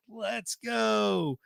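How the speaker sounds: high excited celebration; celebratory cheer. Real, not acted. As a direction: whoop it high as if from a distance. Cheering in the new year.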